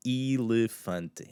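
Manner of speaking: elefante with every vowel clear, slowly; clean pronunciation. Shown as a demonstration. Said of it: "pronounce that nice and clean".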